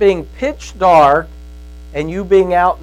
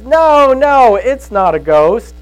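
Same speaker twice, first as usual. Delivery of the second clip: reassuring Jesus; 7 semitones higher, 5 dB louder, slower than usual. Retold or imitated. Jesus calms frightened disciples.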